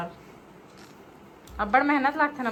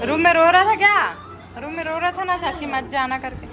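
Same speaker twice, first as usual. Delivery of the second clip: high coaxing; 8 semitones higher, 8 dB louder than usual. Real, not acted. Coaxing child, repeating question.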